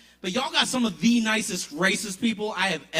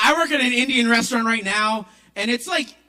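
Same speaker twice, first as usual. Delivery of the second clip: ordinary delivery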